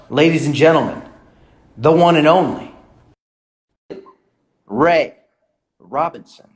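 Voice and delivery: ring announcer. Acted. Introducing guest like ring announcer.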